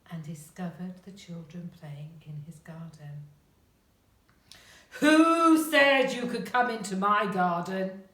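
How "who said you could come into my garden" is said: loud, gruff giant's roar. A narrator's reading. Giant finds children playing in his garden, roaring. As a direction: bellow it in outrage like an angry giant. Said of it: "he roared in a very deep, gruff voice".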